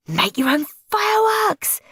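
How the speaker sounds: high happy cry; beaming. Acted. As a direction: grin through it. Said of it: "beamed Henry".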